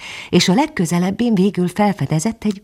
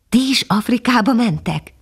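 higher, bright puppy voice full of admiration; young dog introducing himself to the bird; eager young dog; 7 semitones higher than usual. Acted. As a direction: speak like a young, awed puppy.

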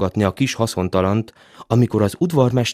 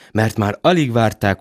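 ordinary delivery

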